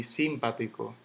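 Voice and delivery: simpático stressed on SIM, SÍM-patico; wrong first stress. Shown as a demonstration. Teacher rejecting a wrong version, simpático stressed on SIM. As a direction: hit the opening SIM of simpático. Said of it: "Yo no digo simpático".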